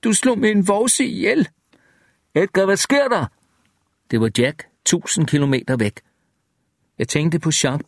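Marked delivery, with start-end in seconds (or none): 0.0-1.5 high-pitched, contained scream; narrated shriek without full volume; screamed accusation. A narrator's reading. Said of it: "der skreg". 2.3-3.3 high-pitched worried call; lightly voiced alarmed friend; distant caller. A narrator's reading. Jack calls out from far away.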